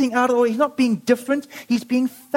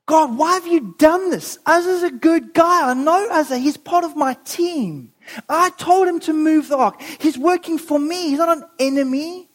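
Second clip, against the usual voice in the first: higher, pleading protest to God; acted outrage, raised in pitch; upset, angry confusion; 5 semitones higher than usual. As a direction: challenge God in baffled indignation. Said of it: "he's upset and he's angry and he, and he's confused".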